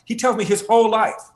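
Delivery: ordinary delivery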